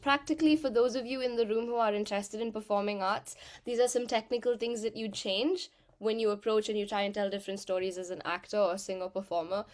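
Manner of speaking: normal talking voice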